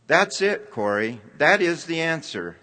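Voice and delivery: a sudden discovery, shared eagerly; excited. Acted. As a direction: sister exclaiming over the verse in excitement. Betsy finds the answer, thrilled.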